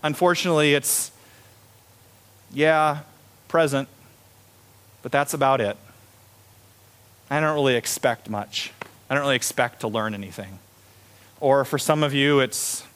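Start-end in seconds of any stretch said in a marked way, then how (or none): 2.5-10.6 unenthusiastic. Acted. Acting unenthusiastic worshipper, contrast to eager.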